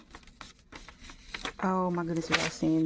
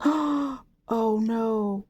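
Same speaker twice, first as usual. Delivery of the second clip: gasped, higher and drawn-out oh no; sudden dismay; 5 semitones higher, 4 dB louder than usual. Real, not acted. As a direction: catch a mistake with a gasp. Suddenly spots a mistake.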